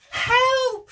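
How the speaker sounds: high, loud cry for help; shouted help. Acted. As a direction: yell it like a sailor in a storm. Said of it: "shouted Jim".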